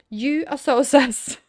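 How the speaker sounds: loud, slow, laughing "so sus"; laughing accusation. Real, not acted. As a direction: tease the suspect through a laugh. Accusing NPC jokingly.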